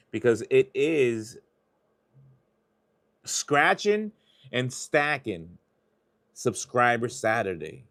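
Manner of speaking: hyping subscriber Saturday to chat; hype announcement. Real, not acted. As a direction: pump up the stream title.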